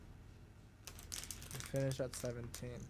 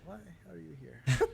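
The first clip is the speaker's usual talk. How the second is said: puzzled clerk voice; 3 semitones higher, 6 dB louder, slower than usual. Retold or imitated. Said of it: "station's like".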